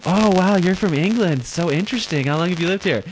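put-on high-pitched, wowed girl voice; impressed bar girls. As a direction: coo with mock fascination, up high. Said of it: "They'll be like".

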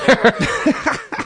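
high burst of laughter. Real, not acted. Host laughs at the victim's quote, high burst of laughter.

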